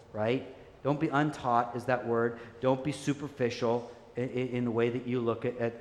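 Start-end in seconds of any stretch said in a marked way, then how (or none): none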